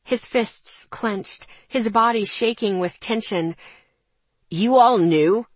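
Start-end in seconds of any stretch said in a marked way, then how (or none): 4.5-5.5 bitten-out anger. Acted. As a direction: bite it out in disbelief. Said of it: "Ethan bit out".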